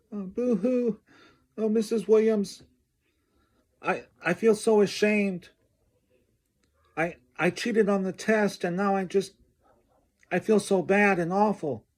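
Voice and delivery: high-pitched, hammed-up boo-hoo sobbing plea; fake crying. Student fakes tears to the teacher to dodge punishment, hammed-up high sobbing. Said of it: "pretended to be upset and crying".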